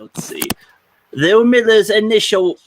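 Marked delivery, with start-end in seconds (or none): 1.1-2.6 put-on higher, louder reading voice; different voice. Podcast host trying a voice on the article, put-on high and loud. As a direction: try out a louder, higher character voice. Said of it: "I'll do a different voice here".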